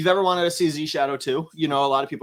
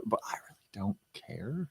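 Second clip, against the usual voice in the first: whispered aside; 7 semitones lower, 15 dB quieter, slower than usual. Acted. Deadpan aside after thanks.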